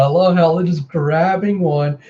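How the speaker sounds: ordinary delivery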